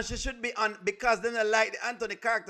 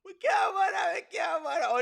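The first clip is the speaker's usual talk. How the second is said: laughing cry; 8 semitones higher than usual. Real, not acted. Laughing outcry.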